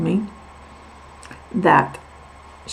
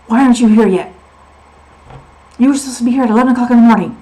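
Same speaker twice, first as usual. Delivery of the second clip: loud, fast, higher scolding; scolding doctor; 5 semitones higher, 11 dB louder, faster than usual. Retold or imitated. Doctor scolding the late patient, loud and fast. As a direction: fire it off like an annoyed doctor. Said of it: "how she said it".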